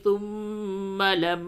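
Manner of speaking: slow, high, drawn-out melodic chant; Quran recitation. Shown as a demonstration. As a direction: chant the full verse slowly with heavy lam in Allah. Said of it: "تو کس طرح سے غنہ کریں گے".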